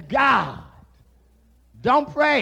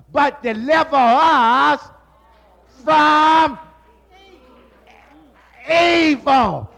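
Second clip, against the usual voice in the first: preached prayer; 3 semitones higher, 3 dB louder than usual. Acted. Preacher leads the congregation in the Lord's Prayer, declaimed. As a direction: proclaim each line of the prayer.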